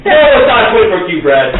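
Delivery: loud exasperated retort. Real, not acted. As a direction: snap it back, fed up and loud. Exasperated GM answering player.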